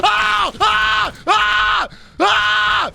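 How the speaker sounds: short high panicked screams; real startled scream, repeated in bursts; YouTuber scream. Real, not acted. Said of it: "YouTuber scream".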